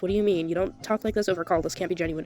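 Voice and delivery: calm, cold replies to the apologizer. Acted. Soph's chat replies read aloud.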